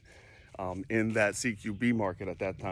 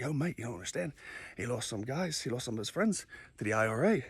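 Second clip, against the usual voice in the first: softer, confiding, imitated British mate; mellow Brit; 6 dB quieter than usual. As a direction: quietly explain on a friend's behalf, lightly British. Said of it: "he was a mellow guy".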